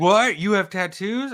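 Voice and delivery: high, shocked double take, pitch jumping up; retold gasp of disbelief, pitched well up; mock surprise. Retold coworkers react to tattoos.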